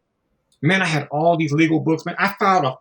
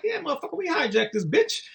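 mock gangster; 8 semitones higher, 6 dB quieter than usual. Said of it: "Because they are gangsters".

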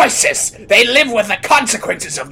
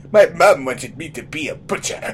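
ordinary delivery

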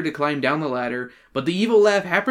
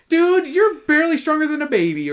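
mock teasing friend; 10 semitones higher, 4 dB louder than usual. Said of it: "I'm sad they're not like".

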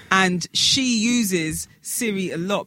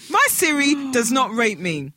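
usual speaking voice

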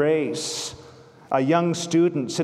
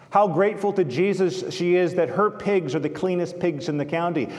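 ordinary delivery

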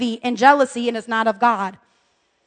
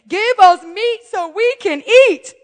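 mock whiny crowd; 6 semitones higher than usual. Mocking the whining people.

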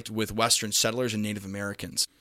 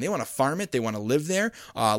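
ordinary talk, nothing marked

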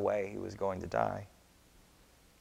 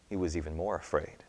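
ordinary delivery

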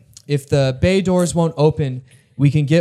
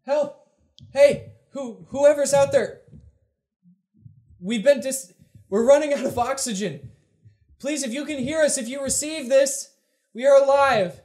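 desperate high distress call; high, panicked plea for rescue; running out of air; 12 semitones higher, 4 dB quieter than usual. Acted. Distress message played on bridge.

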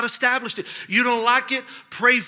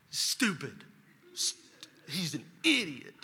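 low, soft grumbling to himself; sulky grumble; 3 semitones lower, 10 dB quieter, slower than usual. Retold or imitated. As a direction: re-enact resentful private name-calling. Re-enacted private grumbling about counselor.

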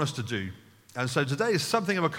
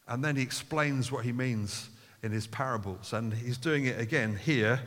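ordinary talk

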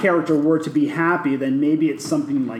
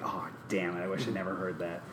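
a mumbled lower sigh of regret, softer; mopey regret; 7 semitones lower, 12 dB quieter, faster than usual. Retold or imitated. To friends, imagining a life without the lesson, mopey mutter. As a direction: mutter it to yourself like lost chances.

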